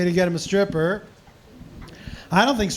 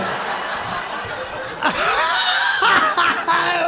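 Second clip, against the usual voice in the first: high shrieking burst of laughter; helpless high laugh; big laugh. Real, not acted. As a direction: crack up at your own story. Storyteller cracks up mid-story.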